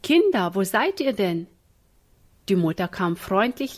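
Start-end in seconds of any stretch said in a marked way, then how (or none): 0.0-1.5 calling out. Acted. Mother comes home calling for the children, cheerily searching. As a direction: call through the house.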